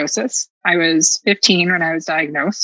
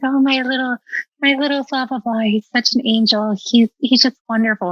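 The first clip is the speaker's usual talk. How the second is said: mock gushing parent; 6 semitones higher than usual. Mocking gushing neighborhood parents.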